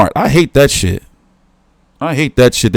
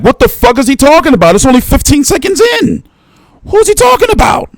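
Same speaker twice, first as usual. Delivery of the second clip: incredulous outburst; 12 semitones higher than usual. Retold or imitated. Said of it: "I'm like".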